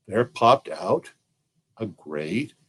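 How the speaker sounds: plain reading voice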